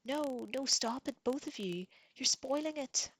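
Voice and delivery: high, loud shriek. A narrator's reading. Sister shrieking at the fighting pair, high shriek. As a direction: shriek at them to stop. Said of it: "Sansa was shrieking".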